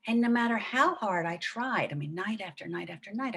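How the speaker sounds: ordinary delivery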